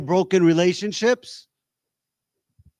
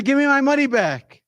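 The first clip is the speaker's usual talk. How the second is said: high-pitched protest of a client; indignant demand; 8 semitones higher than usual. Acted. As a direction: act out demanding a refund. Acted indignant client to therapist.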